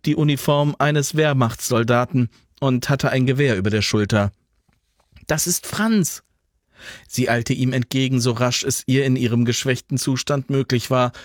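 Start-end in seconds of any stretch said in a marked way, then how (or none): 5.3-6.2 high surprised cry; surprised recognition. A narrator's reading. Alva recognizing the running soldier, surprised. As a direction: recognize him with relief.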